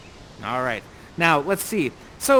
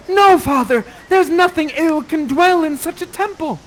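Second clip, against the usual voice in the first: higher, louder protest; pleading Miranda; 9 semitones higher, 8 dB louder than usual. Acted. Miranda standing between Ferdinand and Prospero, pleading. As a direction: defend him to your father. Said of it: "and says".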